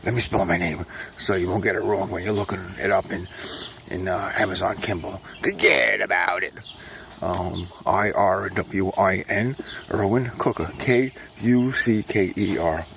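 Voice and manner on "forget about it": Brooklyn catchphrase. Acted. Recurring catchphrase voice.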